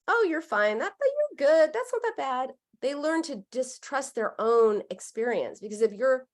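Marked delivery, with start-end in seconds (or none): 0.1-2.5 higher, sweet dismissive reassurance; nice way. Retold or imitated. Parent sweetly brushes off a child's hurt. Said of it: "Even in a nice way".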